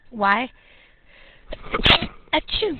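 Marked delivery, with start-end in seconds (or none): 2.3-2.8 sudden real sneeze; sneezing. Real, not acted. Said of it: "I just sneezed".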